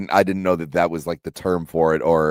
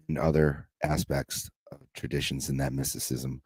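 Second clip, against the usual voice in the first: mock spiritual calm; 6 dB quieter than usual. Co-host introduces himself as spiritually centered, mock serene.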